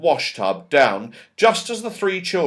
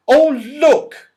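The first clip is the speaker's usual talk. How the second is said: much higher, louder call; excited cry; 12 semitones higher, 8 dB louder than usual. Acted. Franny spotting the clock shop, excited cry. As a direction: call out in delight. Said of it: "cried Franny".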